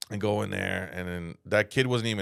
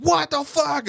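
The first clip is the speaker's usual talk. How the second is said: much higher, louder mock outburst; mom's outburst; 5 dB louder, slower than usual. Imitating his mom losing her temper at school, high outburst. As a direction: blurt it like an outraged mom. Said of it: "she couldn't control her fucking temper".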